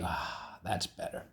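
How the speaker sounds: breathy whispered sigh of relief. Acted. Teacher whispering relief once the letters are in order. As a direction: whisper it, satisfied.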